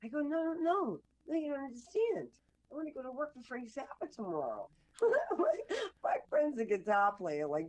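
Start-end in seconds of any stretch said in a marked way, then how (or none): 0.2-4.7 high-pitched retold protest; insist, voice jumping up on the no's; pleading insistence. Young hopeful protesting to her doubting friend, high, insistent plea.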